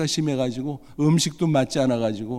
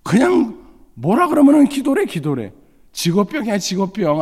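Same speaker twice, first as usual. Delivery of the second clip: teasing son; 7 semitones higher, 5 dB louder than usual. Retold or imitated. Mocking son's teasing.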